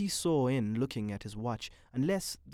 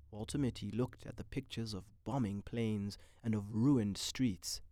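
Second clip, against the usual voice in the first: usual speaking voice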